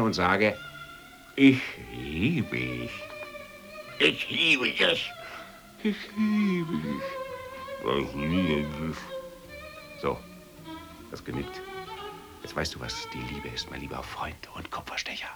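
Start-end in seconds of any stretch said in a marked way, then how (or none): 1.4-9.0 mumbling around a cork held in the teeth; cork between teeth. Shown as a demonstration. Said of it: "den Korken zwischen die Zähne". 12.4-15.3 whispering. Acted. Whispered aside.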